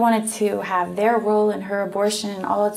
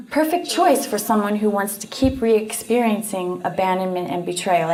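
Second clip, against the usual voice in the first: usual speaking voice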